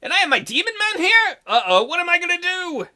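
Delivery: high-pitched, hammed-up dismay; playful acted alarm; mock panic. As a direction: fret theatrically, pitched well up. Heroine panics at friends' arrival.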